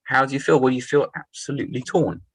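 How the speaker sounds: normal talking voice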